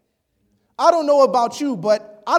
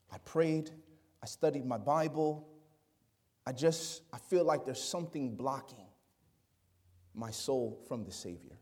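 quiet confession; 11 semitones lower, 14 dB quieter than usual. Retold or imitated. Retelling roommate's troubled confession.

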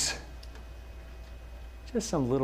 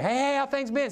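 chirpy greeter; 10 semitones higher than usual. Retold or imitated.

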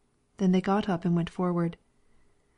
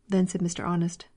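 ordinary delivery

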